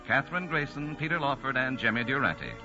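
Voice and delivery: ordinary talk, nothing marked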